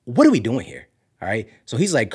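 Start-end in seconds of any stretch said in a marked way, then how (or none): 0.0-0.9 retold freak-out, pitch leaping up; imitating freak-out. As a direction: squawk it like a panicking friend. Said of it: "John was super freaking out".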